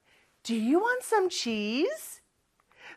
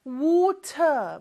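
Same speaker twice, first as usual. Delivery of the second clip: British "water", rounded WAW-tuh with crisp T; British accent; 3 semitones higher than usual. Shown as a demonstration. Teacher shows how Britain says it, British accent. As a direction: say "water" in British English, T sounded, no R. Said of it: "영국에서는 발음을 하기는 해요".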